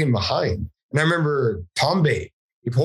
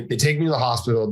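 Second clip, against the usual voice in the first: normal talking voice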